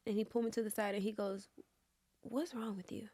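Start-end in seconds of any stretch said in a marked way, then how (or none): 2.2-3.1 much softer, hushed; quiet private question. Retold or imitated. Director pulling actress aside, asking softly. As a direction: ask it gently under the breath.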